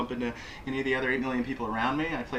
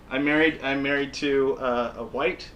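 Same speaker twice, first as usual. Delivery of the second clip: ordinary talk, nothing marked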